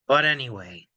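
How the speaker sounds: ordinary talk, nothing marked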